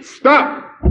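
high, sudden shouted command; yelling. A narrator's reading. Man at the back interrupting the meeting, yelling. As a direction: cut in with a full-voiced yell. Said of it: "yelled a voice from the back".

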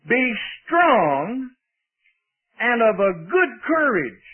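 slow, measured Bible reading; preacher driving the verse home slowly; emphatic scripture reading. Real, not acted. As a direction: proclaim each phrase deliberately, with pauses. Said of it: "watch the language".

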